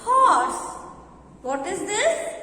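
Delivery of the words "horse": horse said high and louder; teacher revealing horse; stressed answer word. Shown as a demonstration. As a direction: land horse high and loud. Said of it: "this is a picture of".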